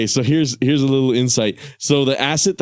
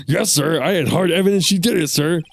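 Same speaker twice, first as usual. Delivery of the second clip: put-on much higher, keen young cop; eager detective voice; 6 semitones higher than usual. Detective explaining the crime scene, high and eager.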